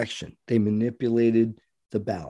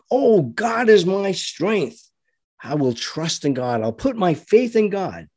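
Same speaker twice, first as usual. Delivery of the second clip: mock pious claim; 5 semitones higher than usual. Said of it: "Too many Christians still claim".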